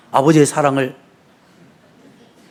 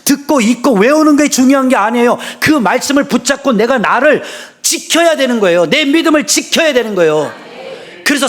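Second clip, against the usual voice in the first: fervent, rising sermon voice; preaching; 5 semitones higher than usual. Real, not acted. Pastor urging the congregation to keep faith, fervent. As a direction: preach it with fire.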